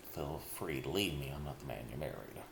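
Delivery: ordinary delivery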